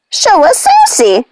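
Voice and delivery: high, slow demanding wail; screaming witch. A narrator's reading. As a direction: demand their sister again.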